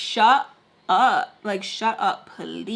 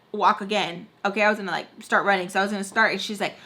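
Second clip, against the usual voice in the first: ordinary talk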